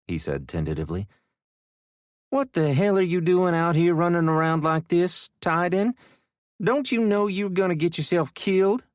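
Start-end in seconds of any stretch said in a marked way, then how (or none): 2.3-8.9 put-on Southwest Louisiana accent. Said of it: "in a Southwest Louisiana accent".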